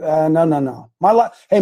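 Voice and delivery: normal talking voice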